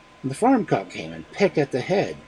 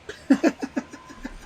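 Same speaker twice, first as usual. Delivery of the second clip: high laugh. Real, not acted. Laughing at own joke.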